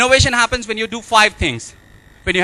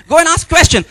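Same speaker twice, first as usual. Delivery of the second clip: louder, higher push of go and ask question; emphatic urging; 7 semitones higher, 6 dB louder than usual. Shown as a demonstration. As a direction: urge them loudly to ask a question. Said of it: "he doesn't believe that you can go and ask question".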